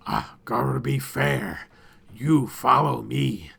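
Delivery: slow, gloating creature voice; lightly voiced creature leader, gloating; masked creature leader. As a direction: savour the offer of a deadly game. Masked leader explains gauntlet.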